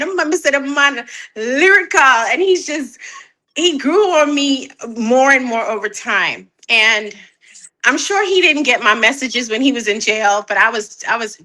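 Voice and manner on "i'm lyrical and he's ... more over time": ordinary talk, nothing marked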